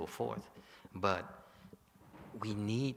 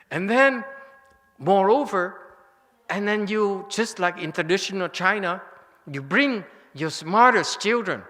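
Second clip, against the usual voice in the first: much higher and louder fervent urging; worked-up sermon to the crowd; excited preaching; 8 semitones higher, 11 dB louder than usual. Real, not acted. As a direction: build it up with fire. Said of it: "I get very emotional and very excited".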